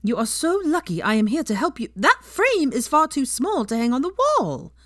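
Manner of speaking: put-on haughty high-pitched girl voice, fussing; prim know-it-all lecturing about pillows; Pansy, bossy and high. A narrator's reading. Pansy lecturing Hermione on decorating, haughty and high.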